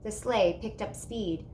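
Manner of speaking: ordinary delivery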